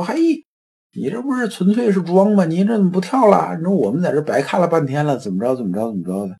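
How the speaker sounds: high, jeering call up from the crowd; mock heckler yelling up at the man. Heckler in the crowd yelling up at the jumper, jeering. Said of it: "就有人扯着嗓子喊说".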